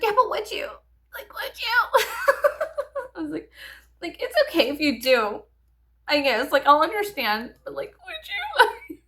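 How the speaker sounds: half-laughing, needling a sleepy partner for an answer; pleading giggly question. Retold or imitated. To her husband at four in the morning: giggly pleading insistence. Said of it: "And I was like".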